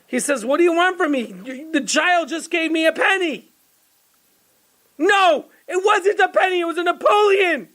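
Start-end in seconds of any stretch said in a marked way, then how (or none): 0.5-3.5 higher, mildly flustered protest; a touch of harried exasperation; flustered storekeeper. Acted. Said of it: "the guy could barely speak while he was being verbally attacked". 5.0-7.7 high-pitched insistent contradiction; heated correction, pitched well above usual; indignant retort. Acted. Acted quarrel, mother screams back at storekeeper.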